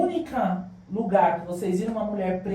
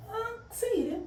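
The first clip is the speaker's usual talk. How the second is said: high, tearful, voice breaking; asking through tears, much higher; choked-up question; 4 dB quieter than usual. Retold or imitated. Invited onto the podcast, she asks it close to tears. Retelling being invited, moved.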